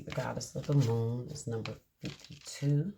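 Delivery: ordinary delivery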